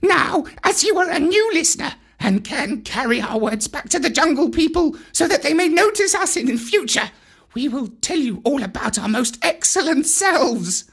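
high, chattering monkey voice; boastful monkeys. Acted. Monkeys bragging to the new listener, high and vain.

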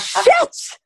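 sudden high startled cry; a startled yelp of surprise at hearing; shocked exclamation. Retold or imitated. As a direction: blurt it out in shock.

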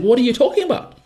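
high, startled disbelief; incredulous. Retold or imitated. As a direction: pitch shoots up in shock at her answer. Retelling his own disbelief.

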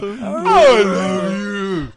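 very slow, high, loud wailing cry; drunk whale noise. Acted. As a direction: stretch it into a drunk whale's song. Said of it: "So that's a whale noise".

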